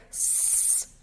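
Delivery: S as a long voiceless hiss; s sound. Shown as a demonstration. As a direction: hiss S like a snake. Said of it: "We don't hear it here".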